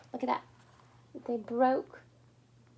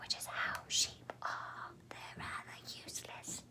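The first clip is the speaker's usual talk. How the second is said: soft whispered aside; 13 dB quieter than usual. Acted. Reader whispering an aside to the listener. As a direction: confide it under your breath.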